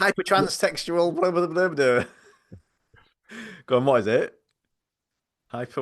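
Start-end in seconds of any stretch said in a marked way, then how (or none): 0.2-2.0 high, show-off mock voice; lightly mocking a clever kid; mock know-it-all. Mocking James's answer, high.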